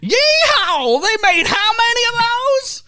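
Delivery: high cowboy yeehaw. Pretend. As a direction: holler like a cowboy. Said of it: "the franchises that make you go".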